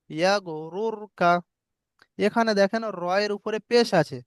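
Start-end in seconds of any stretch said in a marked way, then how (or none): 0.1-1.3 very slow, every syllable drawn out; slow recitation. Shown as a demonstration. Teacher reciting the example slowly for students. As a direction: draw out yeah গরুরকা syllable by syllable.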